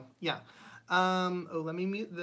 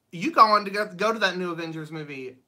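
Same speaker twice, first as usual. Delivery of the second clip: excited; 8 dB louder than usual. Real, not acted. Excited about Avengers movie.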